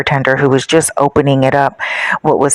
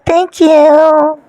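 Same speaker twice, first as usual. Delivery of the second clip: high, drawn-out mimicking of an influencer; put-on sugary influencer squeal; mock influencer thanks; 11 semitones higher, 4 dB louder than usual. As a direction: gush it in a mocking, high-pitched imitation.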